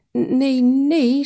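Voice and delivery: high, hesitant stammered no; stammering owl. Acted. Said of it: "stamelde de uil". (de uil stamelt bang en hoog nee)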